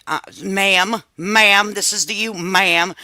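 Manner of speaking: ordinary talk, nothing marked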